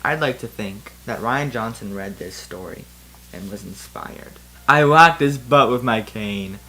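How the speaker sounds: ordinary delivery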